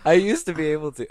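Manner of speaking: high, loud line where the voice cracks; straining so the voice breaks; voice cracking. Real, not acted. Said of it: "Ah, voice crack".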